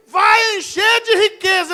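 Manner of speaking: high, slow, loud announcement; acted proclamation. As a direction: proclaim the prize in a drawn-out shout. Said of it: "Aí o cara falava assim".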